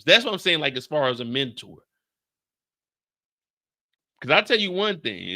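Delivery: ordinary delivery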